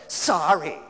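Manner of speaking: put-on grudging, mumbled kid's apology; sulky fake sorry. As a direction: sound like a kid forced to apologize. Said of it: "Ooh, that was really convincing".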